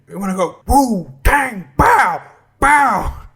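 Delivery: high comic mimicry with shouted sound effects; Dave Chappelle. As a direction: whoop out each noise, high and punchy. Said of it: "like Dave Chappelle said, where he's like".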